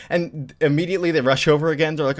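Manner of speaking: ordinary talk, nothing marked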